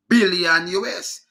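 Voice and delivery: BILLION slow, with a forceful opening B; emphatic B. Shown as a demonstration. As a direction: burst into the B of BILLION. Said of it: "So it a go pronounce".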